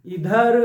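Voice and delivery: इधर said loud and clear from the mouth; loud demo word. Shown as a demonstration. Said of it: "आप स्वयं बोलकर देख लीजिए". (ज़ोर से इधर)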